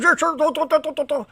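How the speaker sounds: high chirping squirrel noises; squirrel chirps. Shown as a demonstration. Mocking the squirrels with high chirps. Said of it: "I'm gonna go chirp".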